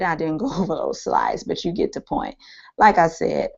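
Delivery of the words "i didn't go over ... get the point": fading, half-whispered, losing voice; tired voice after a long day of talking; hoarse voice. Real, not acted. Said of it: "my voice is going".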